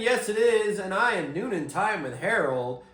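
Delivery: big podcast intro; loud, high show-host opener; announcer voice. Acted.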